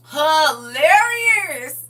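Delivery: stretched out slowly, high and loud through laughter; laughing cry. Real, not acted. Laughing at the rapture jokes from chat, a long high wail. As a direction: wail the word out as you crack up completely.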